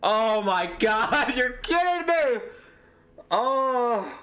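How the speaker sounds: can't believe the call, half laughing; stunned laugh. Real, not acted. Offside call reversal.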